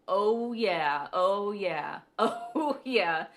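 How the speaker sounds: loud, drawn-out passing voices; loud carefree strangers; strangers' oh yeah. Retold or imitated. Strangers passing in a parking lot, loud and casual. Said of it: "each one of those people say".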